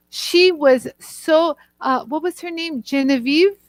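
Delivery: usual speaking voice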